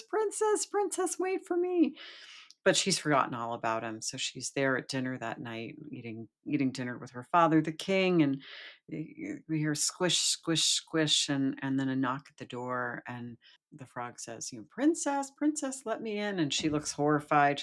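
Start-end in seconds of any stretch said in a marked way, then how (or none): 0.0-1.9 very high, small frog voice; frog calling out. Acted. Frog left behind calls to the fleeing princess, high and pleading. 14.7-16.4 high, pleading little frog; frog calling out. Acted. Frog knocks at the princess's door, high pleading call.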